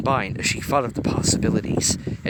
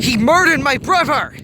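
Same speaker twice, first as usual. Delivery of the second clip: much higher, louder furious cry; furious loud cry, far higher; heated growl; 10 dB louder than usual. A narrator's reading. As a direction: rage at his brother's killer. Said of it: "With a heated growl".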